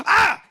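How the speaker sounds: short, high yelp of fright; sudden loud cry of alarm; startled yelp. Acted. Comic recoiling from the sight of the queen, startled yelp. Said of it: "when you see her, you go".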